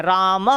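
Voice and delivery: राम said as "raamah"; राम with a Sanskrit "-ah" ending; visarga pronunciation. Shown as a demonstration. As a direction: add an h breath after राम. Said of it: "ऐसे हो जाता है".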